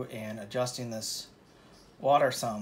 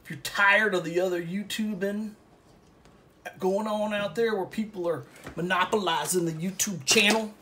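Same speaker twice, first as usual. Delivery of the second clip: higher and louder, building to a shout on art; rallying call; 7 semitones higher, 7 dB louder than usual. Real, not acted. Painter rallying viewers against big YouTubers, building to a shout. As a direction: pump up the crowd, rising to a yell.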